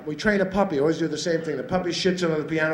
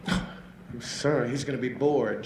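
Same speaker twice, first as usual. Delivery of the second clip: softer, slow, concerned; quiet worried lady; 7 semitones lower, 7 dB quieter, slower than usual. Retold or imitated. Lady leaving her cat alone, softer and slow with worry. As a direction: fret softly and slowly. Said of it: "She said".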